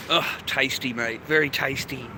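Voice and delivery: normal talking voice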